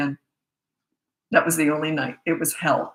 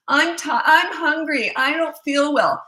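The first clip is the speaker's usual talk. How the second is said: put-on high, drawn-out kid's complaint, louder; whiny stalling child; 9 semitones higher, 4 dB louder, slower than usual. Child calling to parent to avoid bed, high whiny plea. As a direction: plead slowly in a high child's voice.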